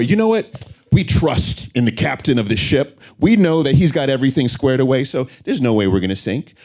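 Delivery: high, loud, carefree floater trusting the captain. Acted. Speaker acting out a complacent passenger, high and carefree. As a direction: sound breezy and sure nothing will go wrong.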